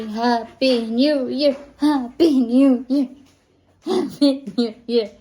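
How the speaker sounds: slow, loud, word by word; coaxing demonstration. Shown as a demonstration. Mother prompting baby to say Happy New Year, slow and loud. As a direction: spell out the greeting slowly for a baby to copy.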